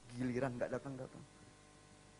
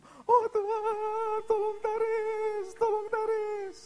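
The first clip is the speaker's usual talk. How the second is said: very high, slow, loud wail; child screaming for help; 11 dB louder, much slower than usual. Retold or imitated. Boy locked in his room, wailing scream. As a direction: keep screaming, dragging out every word. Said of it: "Dia teriak-teriak terus".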